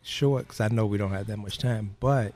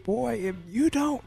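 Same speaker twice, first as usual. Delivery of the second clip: sudden high scolding mom; high-pitched mock scold, cut off; mother scolding; 12 semitones higher, slower than usual. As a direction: snap it out like an angry mother starting a threat.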